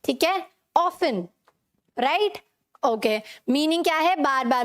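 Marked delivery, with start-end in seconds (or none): 0.8-1.2 often said as OFF-un, no T sound; often pronounced without its T; often with silent T. Shown as a demonstration. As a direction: say often like "awfun", the T left silent. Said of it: "फन".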